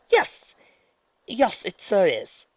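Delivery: plain reading voice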